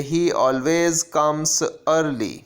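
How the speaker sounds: slow, deliberate reading of the example sentence. Shown as a demonstration. Teacher reading an example sentence to the class, slow and clear. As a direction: read it out slowly like a teacher at the board.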